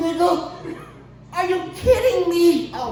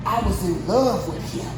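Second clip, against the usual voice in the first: quoting Jada; 6 semitones lower than usual. Retold or imitated. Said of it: "listen to Jada say".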